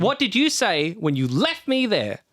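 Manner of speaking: high, forceful demand; angry demand. Acted. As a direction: push him to confess. Yanni accusing Minfang.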